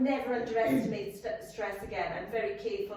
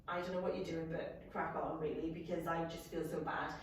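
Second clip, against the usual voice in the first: quiet inner thought; 7 semitones lower, 7 dB quieter than usual. Retold or imitated. Inner thought while feeling ill.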